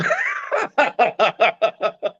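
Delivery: a burst of high laughter. Real, not acted. Friend laughing at the retold theater scene, high laugh. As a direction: laugh out at the story.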